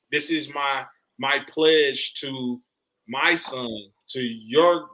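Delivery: ordinary talk, nothing marked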